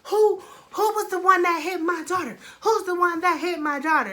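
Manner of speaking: loud, high angry mother demanding answers; loud high mock-angry mother; ignorant mom yelling. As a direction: bellow it like a furious mom. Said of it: "She hella fucking ignorant".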